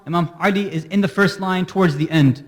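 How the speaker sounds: usual speaking voice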